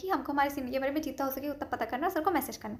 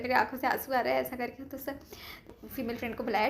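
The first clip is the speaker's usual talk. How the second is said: ordinary talk, nothing marked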